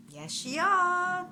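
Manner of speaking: high, slow, loud cackling shriek; slow, high-pitched taunting screech; gnome shriek. A narrator's reading. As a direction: shriek it back like a gleeful gnome. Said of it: "the Redcaps shrieked back".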